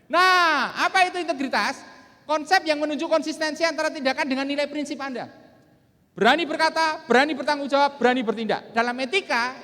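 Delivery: loud, high-pitched motivational delivery; rousing seminar declamation. Real, not acted. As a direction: drive each point home to the hall. Seminar speaker declaims key definition.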